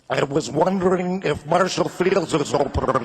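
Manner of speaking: prank caller speaking through water; wobbly, burbling words as if submerged; bubbling underwater voice. Acted. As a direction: gargle the line like you're underwater. Prank call about the flood.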